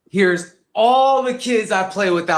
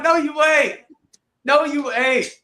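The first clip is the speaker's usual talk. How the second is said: high, whiny "No, you ain't"; exasperated denial; 9 semitones higher than usual. Retold or imitated. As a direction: refuse him with tired disbelief. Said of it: "I'm like".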